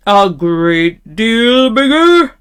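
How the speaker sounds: loud, slow troll question, stretching each word; terrible troll voice. A narrator's reading. Troll questioning the middle-sized goat, loud and slow. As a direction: draw out "great deal bigger" as a troll. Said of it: "in his great, terrible voice".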